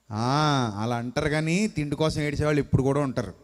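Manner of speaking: ordinary delivery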